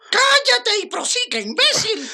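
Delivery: furious shout. Acted. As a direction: bark the order in rage. Jefe furioso insulta al esbirro. (grito furioso)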